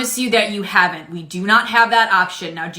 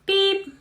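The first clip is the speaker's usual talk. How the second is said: high mock scanner beep; 10 semitones higher than usual. Cashier voicing scanner.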